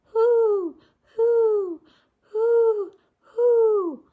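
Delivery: high, loud hooting wind sound; howling wind. A narrator's reading. Narrator voicing the wind in the spooky forest, high howl. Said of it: "the wind began to blow".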